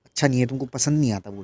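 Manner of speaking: usual speaking voice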